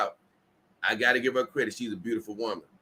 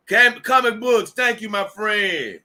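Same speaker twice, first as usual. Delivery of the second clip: loud, high, drawn-out thanks; big slow shout-out to a fan; hearty shout-out; 8 semitones higher, 5 dB louder, slower than usual. Real, not acted. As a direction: call out your thanks, stretching the words. Calling out thanks to a chatter.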